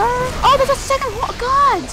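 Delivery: sudden high, loud exasperated cry; real gamer frustration, voice jumping up and loud; frustrated outburst. Real, not acted. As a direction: blurt it out as the second horse appears. Horse attacks during fight.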